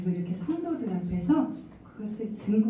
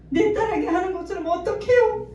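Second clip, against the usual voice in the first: higher, louder, protesting question; exasperated protest; 11 semitones higher, 6 dB louder than usual. Retold or imitated. Mother protesting God's command, higher and frustrated. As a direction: fight the request, frustrated. Arguing back with God.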